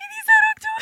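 very high, loud laughing shriek; shrieking laughter. Real, not acted. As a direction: shriek the words out through helpless laughter. Host laughing at the comments.

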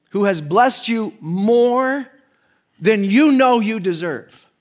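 preaching at full force; slow emphatic build. Acted. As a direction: lean hard on every word.

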